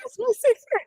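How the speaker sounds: high, loud echo through laughter; laughing. Real, not acted. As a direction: crack up while repeating the punchline. Both cracking up at the joke.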